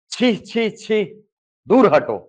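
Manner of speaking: high छी, छी, then a loud दूर हटो; a teacher's mock revulsion, pushing it away; disgusted. Shown as a demonstration. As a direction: recoil from something filthy. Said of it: "विभत्स का भाव है".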